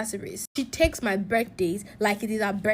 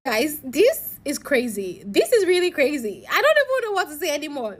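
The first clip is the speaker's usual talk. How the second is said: louder, higher exclamation of disbelief; exasperated disbelief; 5 semitones higher, 5 dB louder than usual. Real, not acted. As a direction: throw it out loud, at a loss. Storyteller exclaims at the outcome.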